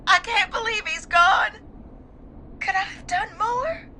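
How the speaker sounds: high, crying disbelief; tearful. Acted. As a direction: plead for absolution through tears.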